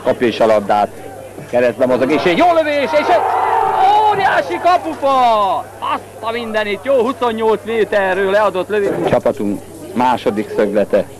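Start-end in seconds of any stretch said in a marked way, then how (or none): 2.1-9.1 shouted high at the near goal; excited shout. Real, not acted. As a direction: yell as it hits the post. Shot hits the post.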